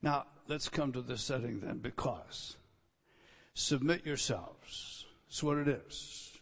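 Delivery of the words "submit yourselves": slow command. Shown as a demonstration. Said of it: "There's a command".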